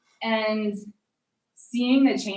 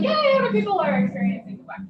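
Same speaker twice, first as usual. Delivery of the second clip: excited; 11 semitones higher, 7 dB louder, faster than usual. Retold or imitated. Quoting her own delighted reaction.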